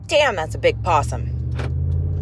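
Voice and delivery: startled. Real, not acted. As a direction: react to the huge possum in surprise. Suddenly spotting a possum.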